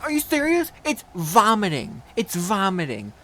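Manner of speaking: high, loud scolding teacher imitation; snapping yell. Third-grade teacher correcting a pupil's word, mock snapping yell. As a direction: snap at the kid, starting high and loud. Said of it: "she snaps to me, yells at me".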